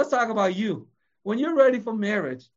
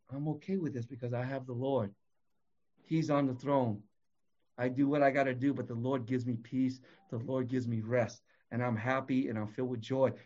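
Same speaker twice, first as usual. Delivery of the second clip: calm serene reply; 7 semitones lower, 8 dB quieter than usual. Pretend. Calm believer answering classmates.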